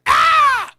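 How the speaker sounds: piercing high shout of Ah; a preacher's sudden yelp; loud high exclamation. Acted. Preacher exclaims mid-sermon.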